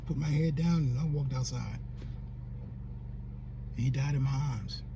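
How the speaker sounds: low, subdued, heavy with grief; quiet, tearful admission; real tearful grief. Real, not acted. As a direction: let it sink, holding back tears. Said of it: "got me up here crying up in here".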